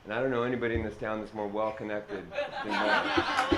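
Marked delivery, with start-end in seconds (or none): 2.6-3.5 laughing. Real, not acted. Laughing at his own joke about Maddox.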